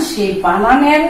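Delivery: usual speaking voice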